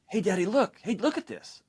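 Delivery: put-on high, eager little girl calling Dad; little daughter voice. Six-year-old daughter to distracted dad, high and eager. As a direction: pipe up like a six-year-old wanting attention. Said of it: "my six-year-old daughter is saying".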